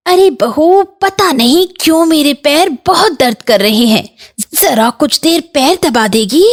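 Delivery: high-pitched plea about aches; complaining aunt. Acted. Aunt asking bahu for leg massage, high complaining.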